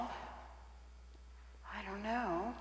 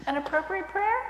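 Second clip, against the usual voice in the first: much higher, unsure asking; doubtful question; 10 semitones higher, 3 dB louder than usual. Acted. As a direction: check with the pastor, voice up high. Skit, wife asks the pastor.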